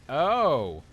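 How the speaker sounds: loud high sudden oh; sudden understanding, out loud; surprised realization. Real, not acted. Realizing answer.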